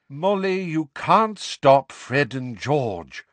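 slow, weary reasoning; tired but firm husband. A narrator's reading. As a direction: reason tiredly, drawing out the words. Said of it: "said Mister Weasley wearily".

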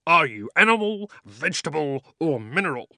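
high, loud, brisk bulldog; gruff bulldog voice. Acted. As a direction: quiz him like a brisk official. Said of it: "in his businesslike way".

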